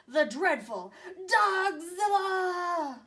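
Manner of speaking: slow, booming monster-movie announcement; dramatic reveal. A narrator's reading. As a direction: draw out the monster's name with dread.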